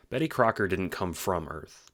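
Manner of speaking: normal talking voice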